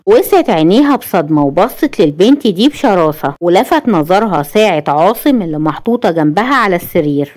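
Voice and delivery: plain reading voice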